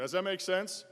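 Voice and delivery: rallying call to the crowd. Shown as a demonstration. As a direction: demand an answer from the room. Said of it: "You guys can give me a little, like, feel".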